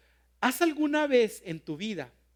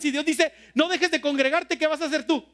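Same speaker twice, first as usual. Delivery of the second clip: pressing, higher and louder preaching; rising fervor; 8 semitones higher, 7 dB louder than usual. Real, not acted. Pastor pressing the congregation with rising fervor. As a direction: push the question home.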